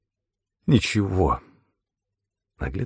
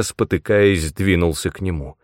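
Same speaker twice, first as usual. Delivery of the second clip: ordinary delivery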